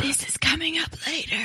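hushed, quick stage whisper; playful whisper. Pretend. Storyteller answers co-host's whispered question, playful stage whisper. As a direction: whisper it back conspiratorially.

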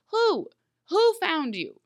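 high-pitched, incredulous demand for details; exasperated questioning. Acted. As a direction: pitch up sharply, demanding an answer. Mock-questioning a vague character speech.

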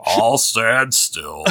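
slow, resigned, mopey drawl; gloomy Eeyore voice. Pretend. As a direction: sound utterly defeated and glum. Eeyore resigned to being shot.

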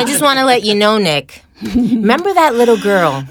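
loud, high and laughing into the reveal; gleeful, bursting to tell him; excited telling Nick. Retold or imitated. Reminding Nick of beauty school, loud gleeful reveal. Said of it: "then I said".

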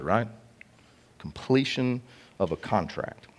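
ordinary delivery

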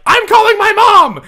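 high loud outraged yell; kid shouting. Retold or imitated. Kid bursting from the chair, high furious shout. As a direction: scream the threat like a furious kid.

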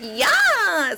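high, loud affected squeal; Becky voice. Acted. Daughter accepting Starbucks from mother, Becky voice. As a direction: answer like an eager put-on Becky. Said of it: "we call it the Becky voice".

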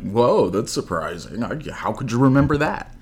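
amazed reaction with a rising, high finish; incredulous, pitch climbing on the question; acted surprise. Shown as a demonstration. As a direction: show the astonishment that was missing. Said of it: "There was no emotion coming from".

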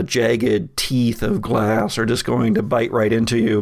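usual speaking voice

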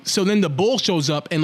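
ordinary talk

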